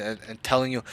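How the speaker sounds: ordinary delivery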